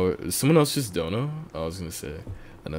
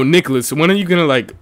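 ordinary delivery

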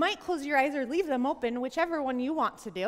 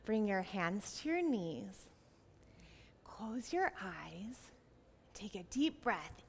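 soft, unhurried hush; calming; 3 semitones lower, 8 dB quieter than usual. Real, not acted. Teacher has children close eyes and breathe, soft and calming. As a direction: ease them into the breath.

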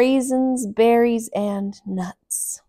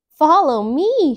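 higher, louder and slow; eager call; 6 semitones higher, 9 dB louder than usual. A narrator's reading. Emmy leading friends into the shopping emporium, loud and eager. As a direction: lead them on cheerfully. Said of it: "she said".

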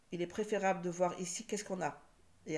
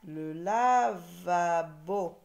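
very slow, loud "lavabo"; "la-va-bo" sounded out firmly for learners; syllable by syllable; 6 dB louder, much slower than usual. Shown as a demonstration. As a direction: stretch each syllable of "lavabo".

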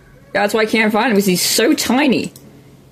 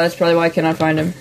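ordinary talk, nothing marked

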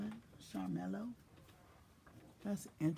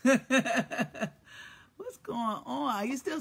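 laughing; 7 semitones higher, 7 dB louder, much slower than usual. Real, not acted. Greeting a friend while laughing.

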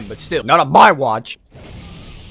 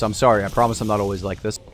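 ordinary talk, nothing marked

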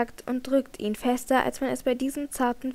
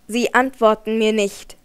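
louder, bitter reproach; angry and desperate; 2 semitones lower, 7 dB louder than usual. A narrator's reading. Raoul snaps at Christine, angry and desperate. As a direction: throw the accusation at her in despair. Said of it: "sagt er wütend und verzweifelt".

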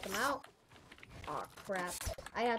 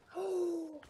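quick high gasp of discovery; sharp gasp; 8 semitones higher than usual. Real, not acted. Player discovering the edit trick works: sudden high gasp. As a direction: gasp as the idea clicks.

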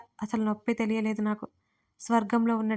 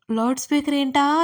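higher, louder, rising on ఏంటా; puzzled question; 4 semitones higher, 7 dB louder than usual. Retold or imitated. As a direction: mimic the listener's baffled question. అనుకునేరు.

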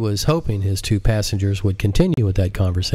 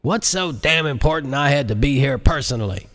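higher, demanding; a raised, impatient president; stormy president; 6 semitones higher than usual. Acted. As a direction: barge in annoyed. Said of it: "stormed into".

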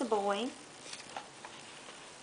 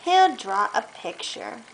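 put-on reading to a child; 5 semitones lower, 4 dB louder than usual. Reads picture book aloud.

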